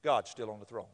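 low, soft, settled certainty; calm reassurance. Acted. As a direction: answer the fretting low and softly. Hushing the worrier.

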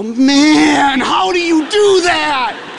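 mock whiny shout. Student begging his roommate for dating tips, mock whiny shout. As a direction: beg to know his secret, hammed up. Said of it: "I go".

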